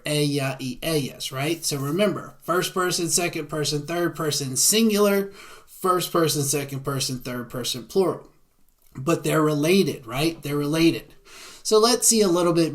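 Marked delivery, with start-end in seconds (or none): none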